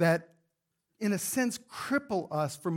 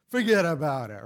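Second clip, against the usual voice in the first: louder, badly faked Italian accent; 4 semitones lower, 4 dB louder than usual. Shown as a demonstration. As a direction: ham it up with a mock Italian accent. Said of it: "in my best Italian accent".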